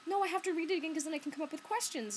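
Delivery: high, rushed mock objection; imitating librarian. Librarian insisting she must reread for questions, high quick protest. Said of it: "And she was like".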